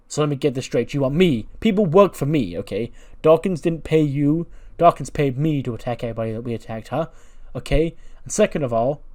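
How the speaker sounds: cocky, riled-up wrestler talking back; indignant rebuff. Acted. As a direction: push back hard, stressing key words. Bivens indignantly rebuffs the offer.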